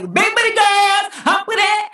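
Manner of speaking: mock hype. Mocking the media message to big booty girls, high mock cheer. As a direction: hype it up like a cheesy ad.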